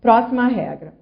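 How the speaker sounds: usual speaking voice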